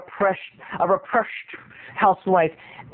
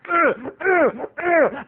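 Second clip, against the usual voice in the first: high wheezy gasp; choking gasp; 7 semitones higher than usual. Retold or imitated. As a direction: choke mid-laugh. Mom choking.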